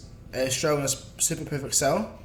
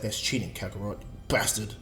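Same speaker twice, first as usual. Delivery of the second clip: low, softer grumbling; resentful grumble; 6 semitones lower, 5 dB quieter than usual. Acted. Vegeta resents Goku's Kaioken training, grumbling low. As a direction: grumble under it at a rival.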